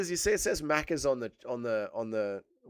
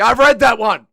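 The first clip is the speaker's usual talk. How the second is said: yelled, pitched way up; exasperated shout; loud outburst; 9 semitones higher, 18 dB louder than usual. Real, not acted. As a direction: bellow it at the chat. Streamer yelling at chat.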